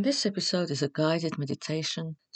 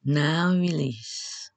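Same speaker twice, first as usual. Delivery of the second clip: measured relaxation instructions with long pauses; guided meditation. Acted.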